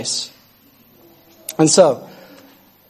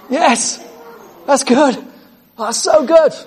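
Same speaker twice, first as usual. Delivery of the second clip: high, thrilled cry of joy; excited yes; 9 semitones higher, slower than usual. Real, not acted. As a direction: cheer it out, delighted by the promise. Preacher exults over scripture.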